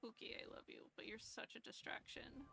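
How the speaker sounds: soft whisper to the cat; very soft whispered scolding. Real, not acted. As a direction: gently whisper a complaint.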